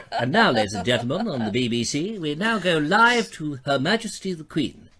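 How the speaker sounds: put-on old-time BBC announcer introducing royalty; forties BBC announcer. Presenter mock-announcing a live link to the Queen, formal BBC voice. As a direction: announce it in measured nineteen-forties BBC tones. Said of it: "sort of nineteen forties B B C".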